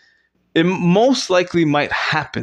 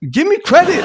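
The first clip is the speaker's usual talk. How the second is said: higher and louder, pleading for credit; a loud, pitched-up half-joking plea; exasperated plea; 12 semitones higher, 5 dB louder than usual. Real, not acted. Pleading after failing to cry.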